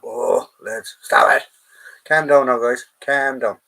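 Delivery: loud call to settle the group down. Real, not acted. Host calling loudly to the rowdy lads to calm down. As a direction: raise the voice to stop them.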